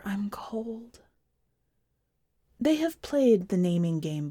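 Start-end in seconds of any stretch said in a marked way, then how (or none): 0.0-1.0 hushed, unvoiced whisper to her husband. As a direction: whisper it close in the dark. Wife whispers.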